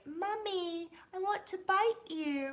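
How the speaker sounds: put-on high, soft little-girl voice; small, high-pitched child impression; three-year-old daughter. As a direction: imitate a toddler talking up to mommy. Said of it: "and she was like".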